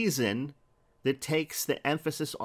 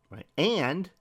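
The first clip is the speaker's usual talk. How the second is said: normal talking voice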